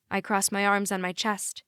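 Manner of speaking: usual speaking voice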